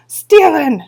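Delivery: a high, loud, proud shout; the little blue men roar their answer. Acted. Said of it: "shouted the blue men".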